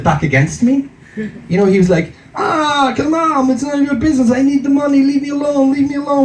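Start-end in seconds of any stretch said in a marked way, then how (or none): 2.4-6.2 put-on high, pleading Fiddler on the Roof accent. Thief begging to be left alone, high mock Fiddler on the Roof accent. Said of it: "the Fiddler on the Roof accent for the thief".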